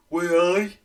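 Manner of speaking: high, loud Geordie cry; hammed-up Geordie exclamation; mock Geordie. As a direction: call it out in exaggerated Geordie. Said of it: "Do a Geordie".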